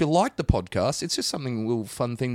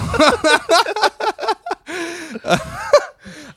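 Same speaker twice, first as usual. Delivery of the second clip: long, loud, high burst of laughter; 8 dB louder than usual. Real, not acted. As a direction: crack up loudly and high. Cracks up mid-plug.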